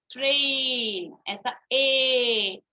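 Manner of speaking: "ट्रेन" and "ए" stretched very slowly; long a demo. Shown as a demonstration. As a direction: drag the long "ए" sound out for the class. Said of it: "ऐसा long ए आता है".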